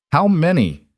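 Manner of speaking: curt, sharp, all business; clipped. A narrator's reading. Ranch boss to his foreman, curt and clipped. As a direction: cut the question short like a boss. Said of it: "His voice was clipped".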